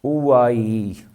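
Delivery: "Ooh, ah, ee" as pure, sustained vowel sounds; vowel sound demo. Shown as a demonstration. Teacher showing students the vowel sounds, sounded out. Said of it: "Vowels are just like zabar, zer and pesh".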